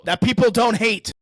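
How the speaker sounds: "people don't hate" loud and high; emphatic challenge. Real, not acted. Podcaster finishing a dig at Disney, loud emphatic challenge. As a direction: drive home "people don't hate" loudly.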